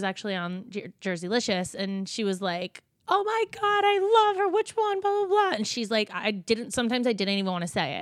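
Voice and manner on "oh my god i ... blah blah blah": high-pitched imitation of a starstruck fan; gushing fan. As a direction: gush mockingly in a much higher voice. Voices a gushing fan of hers.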